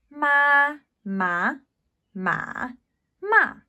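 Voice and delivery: "马" high flat, rising, dipping, then falling; four tones of ma. Shown as a demonstration. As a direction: run "马" through all four tones. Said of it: "what these might sound like".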